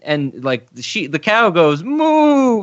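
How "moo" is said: long, high, mournful cow moo; hammed-up plaintive moo; mock sad moo. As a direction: imitate a sorrowful cow, drawing it out. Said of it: "very sadly".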